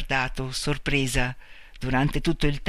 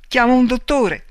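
ordinary delivery

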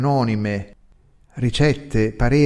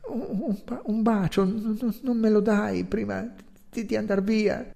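shy, halting stammer, higher and soft; 8 semitones higher, 4 dB quieter than usual. A narrator's reading. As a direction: stumble over every word, timidly asking. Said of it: "D'un tratto balbettò".

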